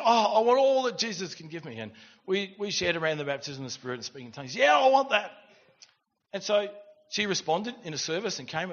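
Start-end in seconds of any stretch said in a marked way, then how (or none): 0.0-1.8 higher, louder eager plea; eager convert, imitated; retold eagerness. Retelling an eager woman's response. 4.6-5.3 loud, high burst of enthusiasm; eager, raised-voice outburst; retold eager yes. Retelling an eager woman's response.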